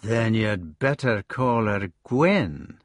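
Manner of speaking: slow, sly insinuation. Acted. Agravain needling Gareth about Lancelot, slow and sly. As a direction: drop the barb slowly and deliberately. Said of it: "He said with a slow tone".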